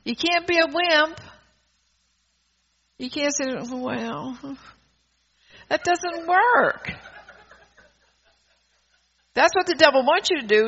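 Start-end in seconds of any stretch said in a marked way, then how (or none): 3.5-6.9 mock whimpering. Preacher mimicking a wimp who complains, mock whimper. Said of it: "and whimper and complain".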